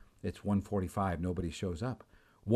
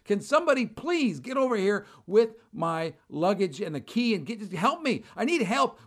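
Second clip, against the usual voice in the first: high, exasperated plea for help; retold yelling, begging for someone to come; 8 semitones higher than usual. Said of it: "I'm literally yelling".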